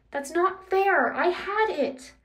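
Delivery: high, slow, drawn-out complaint; whining protest. A narrator's reading. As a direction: protest like you've been cheated. Percy protests to his nemesis.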